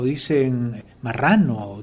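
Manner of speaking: ordinary delivery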